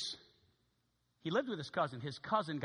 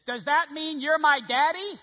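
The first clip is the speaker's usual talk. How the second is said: put-on higher, louder and slow, childlike hope; Ben's hopeful voice; 10 semitones higher, 12 dB louder, slower than usual. Ben asks the narrator if he is his daddy, high and hopeful.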